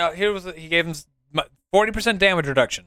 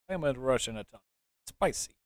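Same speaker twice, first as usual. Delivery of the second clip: mock Russian accent; 5 semitones lower, 11 dB quieter, faster than usual. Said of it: "Russian accent".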